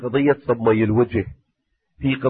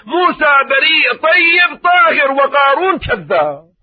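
impassioned cry; 12 semitones higher, 6 dB louder, slower than usual. Acted. Preacher's impassioned opening cry.

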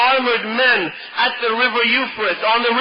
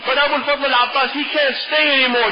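ordinary talk, nothing marked

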